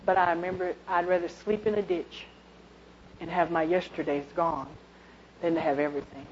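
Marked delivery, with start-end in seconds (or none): none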